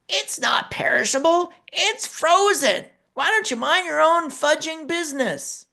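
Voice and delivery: imitating a furious mother, high and strained; indignant angry retort. Angry mother correcting the speaker, high and indignant. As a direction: hammer each word back in mock fury.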